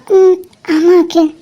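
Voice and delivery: slow, halting words through crying; tearful stammer. Acted. Wife answering Akhil's question, crying and stammering. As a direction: choke out a reluctant yes while sobbing. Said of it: "நீ திக்கி திக்கி சொல்லும் போதே".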